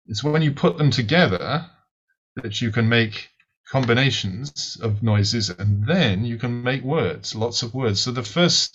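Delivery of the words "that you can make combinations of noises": normal talking voice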